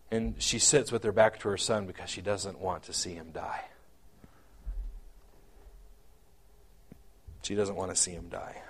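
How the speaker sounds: hushed, unvoiced whisper. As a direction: whisper it as if at a bedside. Whispers the mother's grief.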